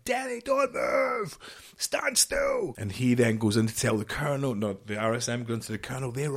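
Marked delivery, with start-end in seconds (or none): 0.1-2.7 high, slow yelled command; shouting. Retold or imitated. Sergeant major shouting at a soldier to freeze, high and slow. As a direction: yell it across the room to stop him.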